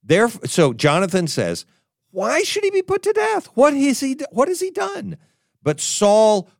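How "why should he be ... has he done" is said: much higher, pleading son protesting; Jonathan's anguished high-pitched plea to his father. Acted. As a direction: beg for his friend's life, voice up high. Listen to the conflict between these.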